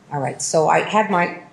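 ordinary delivery

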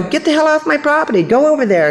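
high, indignant dismissal; ungrateful attitude. Retold or imitated. Woman ordering the guard off her property, indignant. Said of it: "no attitude of gratitude".

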